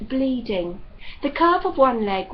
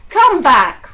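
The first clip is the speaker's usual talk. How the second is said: loud sharp call up the tree; 5 semitones higher, 12 dB louder than usual. A narrator's reading. Ross calling Demelza down from the tree, loud. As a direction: shout her back down. Ross calls to climbing girl.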